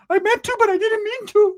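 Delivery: high, loud flustered whine; mock panicked pleading. Ricky excusing the killing, high flustered whine.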